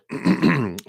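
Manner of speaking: throat clear, a real rough cough. Real, not acted. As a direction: clear the throat mid-sentence. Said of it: "my apologies for my clearing my throat there".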